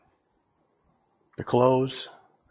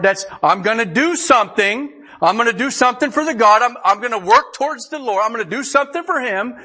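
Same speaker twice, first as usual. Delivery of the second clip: louder, higher pulpit push; preaching at full force; 8 semitones higher, 4 dB louder than usual. Acted. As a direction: drive it home to the congregation. Preacher builds to exhortation.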